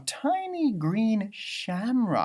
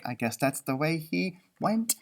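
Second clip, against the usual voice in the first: plain reading voice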